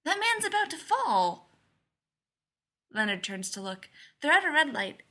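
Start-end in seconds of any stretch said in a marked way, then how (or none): 0.1-1.5 put-on high-pitched alarmed little girl; astonished, fearful child. A narrator's reading. Joe spotting a man about to fall, astonished and fearful. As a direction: point out danger in a high child's voice. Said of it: "one of astonishment and fear".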